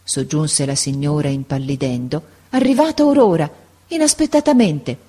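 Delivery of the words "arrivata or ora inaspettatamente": much higher, breaking big news; agitated announcement. A narrator's reading. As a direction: agitation mounting toward the reveal. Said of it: "sempre più agitata".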